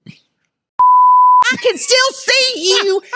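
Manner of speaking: high, loud, drawn-out mock yell; snapping. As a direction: imitate mama calling someone out at full pitch. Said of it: "she snaps. She goes".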